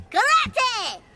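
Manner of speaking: high, sharp battle cry; karate yell. Acted. Boy leaping into a fight, karate yell. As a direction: shriek it like a kung fu kick.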